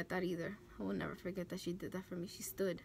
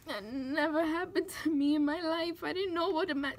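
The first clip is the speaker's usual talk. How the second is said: crying; 6 semitones higher, 6 dB louder than usual. Real, not acted. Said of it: "pick up those tears".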